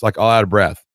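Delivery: normal talking voice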